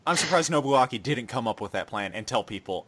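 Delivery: ordinary delivery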